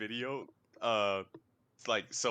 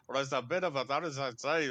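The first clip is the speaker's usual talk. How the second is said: imitated Glaswegian gabble; garbled mock-Glaswegian speech; Glaswegian accent; 2 semitones higher than usual. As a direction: mimic a thick Glasgow accent. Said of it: "The Glaswegian accent?".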